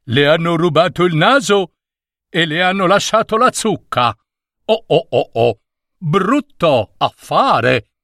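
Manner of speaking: quick high jeering voice of the crowd; mocking onlookers. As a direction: tease him, fast and amused. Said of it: "e rideva".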